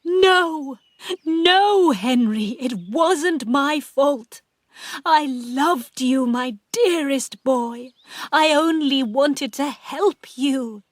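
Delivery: high, loud, drawn-out pleas; distraught pleading. A narrator's reading. Aunt begging Henry's ghost to believe her, distraught. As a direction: plead desperately with the dead.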